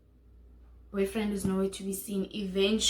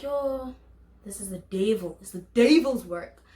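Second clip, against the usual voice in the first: exasperated; 6 dB louder than usual. Retold or imitated. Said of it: "So I got so pissed".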